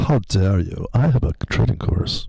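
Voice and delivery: haughty offended snob voice; snooty rich guy. Pretend. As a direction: play an outraged rich snob. Mock posh rich snob.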